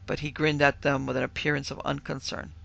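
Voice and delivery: ordinary delivery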